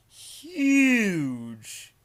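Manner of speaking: "huge" stretched out long, high and loud; "huge" blown up to show enormous size; hugely exaggerated HUGE. A narrator's reading. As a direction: draw "huge" right out in a loud swoop. Exaggerates the boss's great size.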